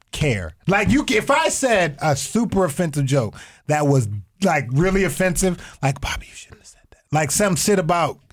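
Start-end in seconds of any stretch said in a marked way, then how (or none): 6.0-7.0 hushed, breathy scolding; mock whisper. Mocking a scold.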